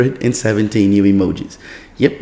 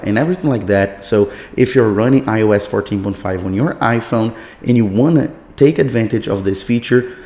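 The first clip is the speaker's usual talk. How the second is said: ordinary talk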